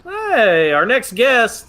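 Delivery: high, loud guest introduction; mock show announcer. Host introducing Arnold as guest, loud announcer welcome. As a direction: hype up the arriving guest.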